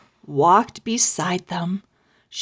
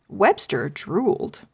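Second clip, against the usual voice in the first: usual speaking voice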